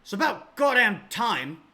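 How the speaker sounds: fed up, it's about time; irritated captain. Acted. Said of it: "Horblack looks at you and he says".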